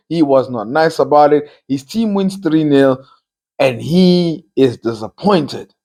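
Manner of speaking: normal talking voice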